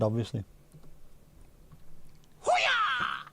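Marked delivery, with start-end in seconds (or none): 2.4-3.2 very high comic whoop; mock whoop. Host cheering after asking for injections, high whoop.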